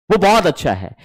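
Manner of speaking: ordinary talk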